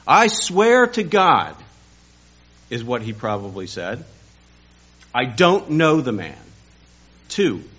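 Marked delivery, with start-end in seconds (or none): 0.1-1.6 loud, slow, high vow; drawn-out loud oath; sworn oath. Retold or imitated. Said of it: "He denied it again with an oath". 5.1-6.4 firm denial. Retold or imitated. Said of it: "He denied it again with an oath".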